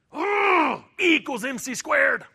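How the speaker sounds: high, loud, hammed-up eureka cry with a laugh; mock eureka shout. Preacher jokes he never angrily blurted physics, hammed-up shout. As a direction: blurt it like a silly discovery. Joking mock outburst about anger.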